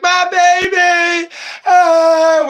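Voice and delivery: put-on high, drawn-out wail of "my baby"; mock tearful cry. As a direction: wail it loudly, hamming up an emotional reunion. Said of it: "we'd probably start crying".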